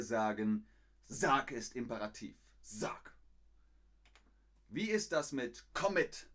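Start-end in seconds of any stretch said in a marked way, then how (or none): 5.7-6.3 sharp, high barked order; military command. Shown as a demonstration. Teacher playing an army officer giving orders, barked command. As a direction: bark it like a drill order.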